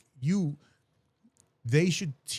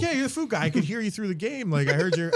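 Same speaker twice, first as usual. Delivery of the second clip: higher, eager fan voice; pitching coach telling him he's the food guy, eagerly; imitating the coach; 7 semitones higher than usual. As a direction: play the excited coach spotting him. Coach quoted recognizing him.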